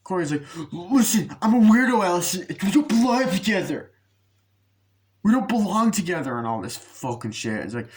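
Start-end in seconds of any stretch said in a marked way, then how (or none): 0.7-3.9 high, loud, hammed-up protest; mock anguished outburst. Corey breaking away from Allison, mock anguished outburst. 5.2-6.4 high and loud, overdramatic; mock anguished protest. Corey telling Allison they must part, mock anguished protest.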